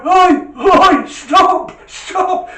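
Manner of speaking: high, loud cries of stop; frantic shouting. Retold or imitated. Old man on the towed bike yelling for the driver to stop, high and loud. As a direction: yell after the speeding car.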